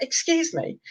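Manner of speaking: high, soft put-on posh voice; Spike. Bearded dragon seeking attention again, posh high voice. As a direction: imitate a very posh speaker, quietly and politely. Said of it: "this really posh voice".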